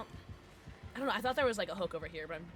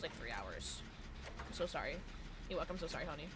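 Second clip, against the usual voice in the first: ordinary delivery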